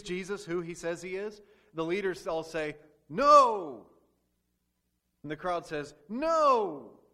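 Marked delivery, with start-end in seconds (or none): 3.1-3.9 high, loud flat refusal; leaders' shout. Acted. Leaders answering. 6.1-7.0 high, loud refusal; crowd's no. Acted. Crowd answering.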